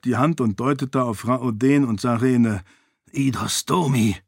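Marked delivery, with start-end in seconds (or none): 3.1-4.2 cursing. A narrator's reading. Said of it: "fluchte Raoden".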